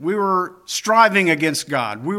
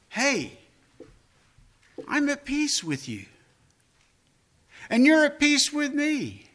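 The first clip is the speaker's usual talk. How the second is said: gentle voice of God; 8 semitones higher than usual. Acted. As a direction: speak as God comforting his people, gently. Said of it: "the Lord says to me and you".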